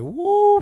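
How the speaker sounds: a high, loud whoop at the cold; cold woo. Retold or imitated. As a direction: yelp high and loud, like stepping into a freezer. Shocked by the cold room.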